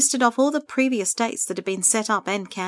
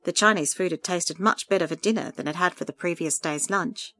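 ordinary delivery

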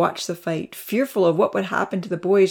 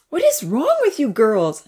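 higher-pitched indignant demand; 6 semitones higher, 4 dB louder than usual. A narrator's reading. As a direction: demand an answer, pitch lifted. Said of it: "another voice demanded".

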